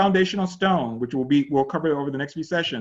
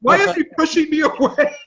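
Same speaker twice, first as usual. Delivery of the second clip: high, louder, laughing whine as the wife; laughing wife imitation; 12 semitones higher, 5 dB louder than usual. Pastor mimics the wife's complaint to his class, laughing. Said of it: "she said".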